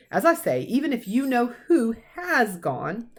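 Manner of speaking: lightly voiced stern old professor, cross; a hint of a brisk Scottish teacher scolding; McGonagall. Professor scolding Dumbledore about careless celebrating, lightly stern.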